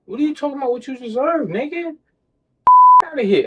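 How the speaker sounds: God, scoffing at their sense of entitlement, lightly voiced; God's dismissal. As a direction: brush them off like God would. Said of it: "God looking at you like".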